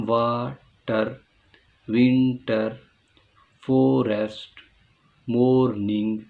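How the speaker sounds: वाटर, विंटर, फॉरेस्ट, मॉर्निंग each broken into two beats; two-syllable split. Shown as a demonstration. Teacher listing two-syllable words, each split in two. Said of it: "two syllable words हैं".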